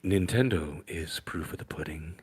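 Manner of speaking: unvoiced whisper, close and intimate; ASMR whisper. Shown as a demonstration. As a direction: breathe the words in a hushed ASMR whisper. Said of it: "in your A S M R voice".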